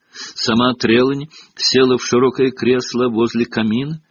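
ordinary delivery, nothing marked

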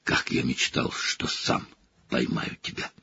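unvoiced gloating whisper; whispered revenge to a captive. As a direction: hiss it close, savouring revenge. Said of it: "шепнул Снегг Блэку".

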